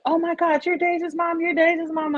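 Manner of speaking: high-pitched, repeated gush of recognition; retold excitement, held up high; excited classmate. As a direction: imitate a classmate thrilled to meet her.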